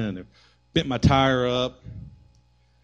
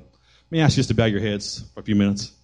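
altar call; 2 semitones lower than usual. Acted. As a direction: invite gently with heads bowed.

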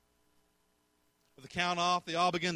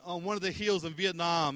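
ordinary talk

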